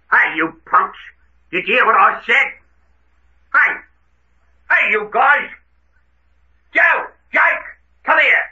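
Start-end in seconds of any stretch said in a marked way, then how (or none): 3.5-8.4 high, urgent summons to the gang; raised-pitch holler for help; urgent calling. Acted. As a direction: call your accomplices over, sharp and high. Urgently summoning accomplices after escape.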